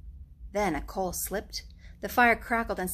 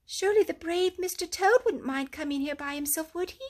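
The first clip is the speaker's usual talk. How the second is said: lightly higher timid mole; Mole's voice; 8 semitones higher than usual. A narrator's reading. Mole timidly asks Rat about Toad. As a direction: ask hoping for reassurance.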